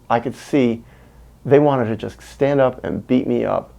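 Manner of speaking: normal talking voice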